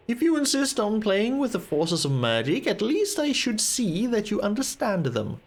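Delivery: formal, lilting mentor reading; a hint of lofty wizard; lightly voiced chiding wizard. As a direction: scold the apprentice with dry restraint.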